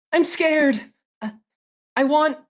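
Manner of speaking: high, loud imitation of a child; mimicking scared boy. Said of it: "I will use my actor feat".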